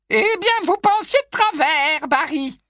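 put-on high, loud, delighted old busybody; high loud aunt enjoying the shock she caused; old aunt tossing it off. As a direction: fling it at him, pleased with herself. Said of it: "lança la tante Muriel".

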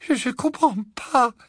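high, faltering stammer; bewildered murmur. Acted. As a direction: stammer in frightened confusion. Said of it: "murmura-t-elle". (balbutiement aigu et désemparé)

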